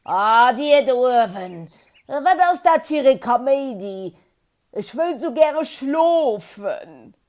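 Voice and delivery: loud, grumbling sleepy tortoise; a woken-up tortoise grumbling up at the branches; gruff, drowsy complaint; grumpy tortoise. A narrator's reading. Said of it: "dann huet du gegranzt".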